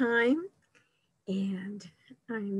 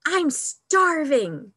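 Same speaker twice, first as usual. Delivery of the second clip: high, slow, drawn-out wail of hunger; dramatic hunger; 7 semitones higher, 5 dB louder, slower than usual. Acted. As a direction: overdo it like a hungry cub. Hungry cub pounces on bear.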